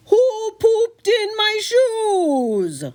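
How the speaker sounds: high, drawn-out outraged yell; fishmonger yelling. Acted. Fishmonger yells after the fleeing trio, outraged. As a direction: bellow it across town. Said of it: "hear the fishmonger yell".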